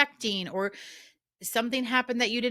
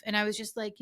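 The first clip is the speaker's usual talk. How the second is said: normal talking voice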